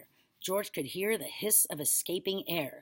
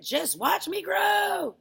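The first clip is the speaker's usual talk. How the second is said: very high, drawn-out yell; triumphant shout; 13 semitones higher, 8 dB louder, much slower than usual. A narrator's reading. Grandma showing off her growth, triumphant shout. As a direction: shout it slowly, stretching the last word. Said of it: "she shouted".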